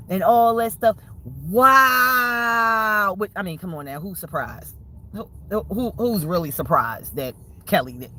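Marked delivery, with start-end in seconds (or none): 1.5-3.2 mock amazement. Real, not acted.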